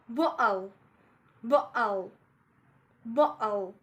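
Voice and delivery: "bottle" with a catch in place of T; glottal stop. Shown as a demonstration. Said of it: "so I glottalize T".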